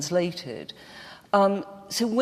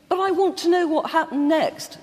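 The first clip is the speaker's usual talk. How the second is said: higher and louder, a mock plea to know more; imitating an eager reader; 10 semitones higher, 5 dB louder than usual. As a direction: play the frustrated reader begging for the next page. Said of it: "and you think".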